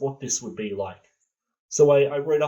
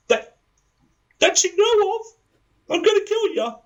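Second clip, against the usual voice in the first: very high, loud comic threat; yelling assistant; 4 dB louder than usual. Acted. Assistant threatens to kill the boss, high-pitched yelling. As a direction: shout back in a squeaky high put-on voice.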